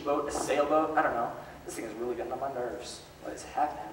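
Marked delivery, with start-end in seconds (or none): 1.7-3.9 quiet aside. Real, not acted. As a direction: mutter it under your breath. Aside to a fidgety child.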